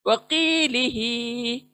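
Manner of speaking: वकीलिही with की and ही long, व and ली quick; slow tajweed reading of वकीलिही; drawn-out recitation. Shown as a demonstration. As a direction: stretch की and ही in वकीलिही. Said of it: "की खींचना है, ही खींचना है".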